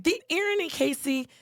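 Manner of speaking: high, emphatic declaration. Retold or imitated. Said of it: "And Starla's like".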